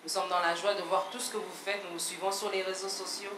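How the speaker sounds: usual speaking voice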